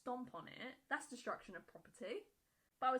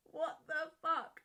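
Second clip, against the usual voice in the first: incredulous; 5 semitones higher, 6 dB louder, slower than usual. Real, not acted. Disbelief at the story.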